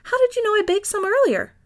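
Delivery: delighted. A narrator's reading. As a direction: gush with delight. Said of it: "clapped and said".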